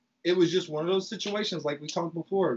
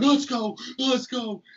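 fired-up shout; 6 semitones higher, slower than usual. Retold or imitated. Getting back up after the fall, fired-up shout. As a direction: hype yourself up for the fight. Calling for a fight after falling.

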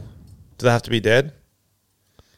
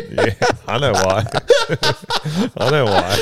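laughing; 3 semitones higher than usual. Real, not acted. Laughs at the joke.

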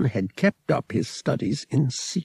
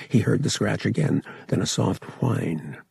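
plain reading voice

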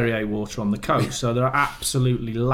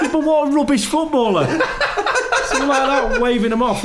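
high, loud, laughing self-mockery; laughing at himself; 5 dB louder than usual. Real, not acted. Guest laughing at his own foolish footballing. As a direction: laugh through it, mocking yourself. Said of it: "laughing at himself".

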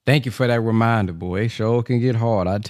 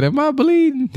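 a much higher, louder mock-dazed question; dazed backflip guy; 4 dB louder than usual. Caller retelling a viral backflip video, high mock-dazed quote. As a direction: imitate the dude who just cracked his back. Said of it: "got up and said".

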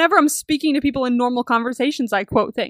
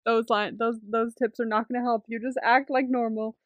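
gentle advice; 2 semitones lower, 9 dB quieter than usual. Retold or imitated. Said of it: "He's like".

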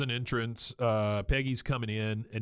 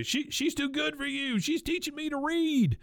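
much higher falsetto cry of protest; squeaky, hammed-up plea; high mock protest. [name] calling back while being hauled off, high mock cry. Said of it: "you hear [name]".

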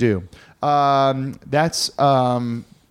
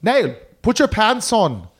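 higher, hammed-up Irish accent; mock Irish scolding; 4 semitones higher than usual. Parent scolding Nail near the children, mock Irish accent. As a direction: chide him as a put-on Irish parent.

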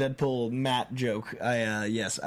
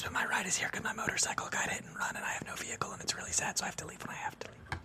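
lost voice, raspy whisper; 6 semitones higher, 8 dB quieter than usual. Real, not acted. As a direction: whisper through a voice that's gone. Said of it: "I have no voice 'cause of the show".

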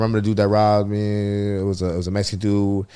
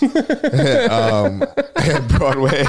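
laughing; 7 semitones higher, 5 dB louder, much slower than usual. Real, not acted. Laughing at own joke.

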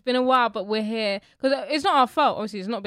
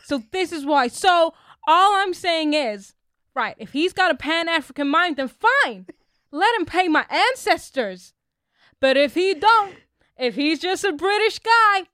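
loud, slow, high mock ranting lecture; hammed-up impression of a ranting preacher; mock Doctor Umar rant; 6 semitones higher, 7 dB louder, slower than usual. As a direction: ham up a preachy rant, louder and louder. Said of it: "he was emphasizing that British colonizer accent".

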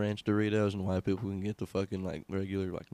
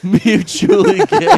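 mock squeal; 14 dB louder, slower than usual. Mocking friend's joke line.

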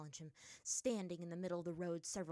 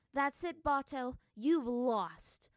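loud, raised, grim verdict; dark pronouncement; 7 semitones higher, 8 dB louder, much slower than usual. A narrator's reading. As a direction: declare the enemy beaten, grimly. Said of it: "Katara said darkly".

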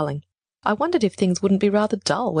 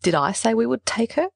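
higher, mildly cross demand; 5 semitones higher than usual. A narrator's reading. Niece blamed for taking the cat, cross. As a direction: throw it back crossly. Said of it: "I demanded crossly".

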